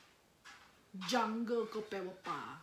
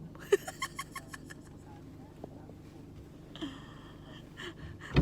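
long high breathy giggle. Real, not acted. Laughing at her own nosiness, long and high. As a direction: giggle at herself.

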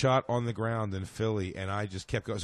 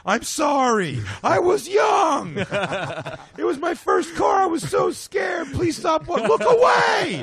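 shrill desperate begging, high and loud; high pleading shout; 6 dB louder, slower than usual. Pretend. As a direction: beg the ghost to look away, screaming high. Joe voices begging to the ghost.

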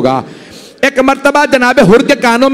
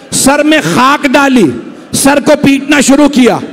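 rising preaching; 5 semitones higher than usual. Acted. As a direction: drive the narration toward the climax. Preacher builds toward climax.

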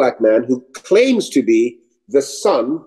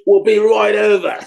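high, eager acceptance; eager reply; 7 semitones higher than usual. Retold or imitated. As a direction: jump at the invitation, pitched up. Acted eager reply to invitation.

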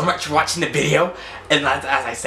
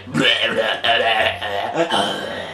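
low, silly imitated "bleh" noises; mocking bleh noises; 5 semitones lower, much slower than usual. Reactor copying her inappropriate noise, low mocking blehs. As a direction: drop low and blurt out mock gross noises. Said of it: "She was like".

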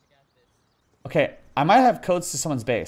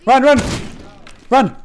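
high, loud repeated yell; urgent shout; 8 semitones higher, 5 dB louder than usual. Real, not acted. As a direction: scream at the team to flee. Urging teammates to flee.

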